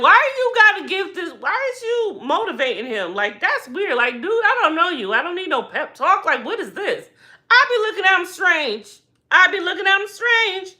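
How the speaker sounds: high-pitched, baffled side commentary; incredulous. Real, not acted. Mock-baffled commentary on the guard.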